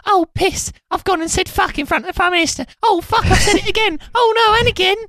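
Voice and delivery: flustered, mortified high voice; high-pitched comic panic at swearing. Acted. Mocking the writer as a sweary film character, high flustered voice. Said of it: "he is just the [name] character".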